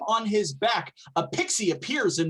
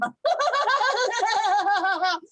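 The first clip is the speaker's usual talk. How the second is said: high pixie laugh; 4 dB louder than usual. Acted. Pixie laughs after curse.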